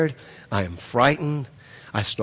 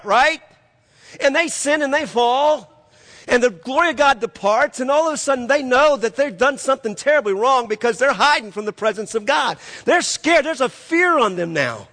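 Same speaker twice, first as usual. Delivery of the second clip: higher-pitched fervent preaching; preaching at full force; 8 semitones higher than usual. Acted. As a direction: keep the fire in it.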